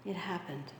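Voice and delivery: soft, low, deflated sigh of resignation; a mild, disappointed sigh; resigned sigh. Retold or imitated. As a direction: sigh it out like a let-down fan. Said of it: "You sigh and you say".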